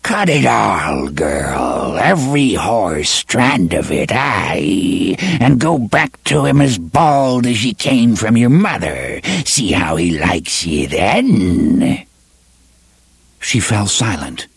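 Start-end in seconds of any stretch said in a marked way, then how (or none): 0.0-12.0 growling, whining witch voice; growling old hag. Acted. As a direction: snarl it like an old crone. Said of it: "the growling, whining cadences of the old woman".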